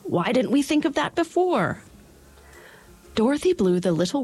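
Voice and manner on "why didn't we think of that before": higher, eager scarecrow voice; cheerfully exasperated; excited scarecrow. A narrator's reading. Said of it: "cried the Scarecrow".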